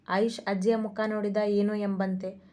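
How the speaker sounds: usual speaking voice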